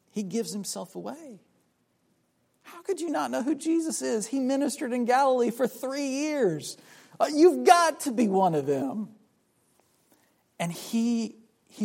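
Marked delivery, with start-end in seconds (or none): 2.6-9.1 incredulous accusation. Retold or imitated. Bystanders confront Peter, acted.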